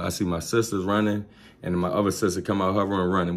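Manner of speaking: usual speaking voice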